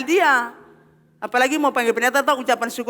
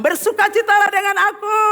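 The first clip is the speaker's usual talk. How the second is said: proclaimed shout; 7 semitones higher than usual. Acted. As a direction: shout the joy out to the congregation. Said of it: "Makanya dia bilang".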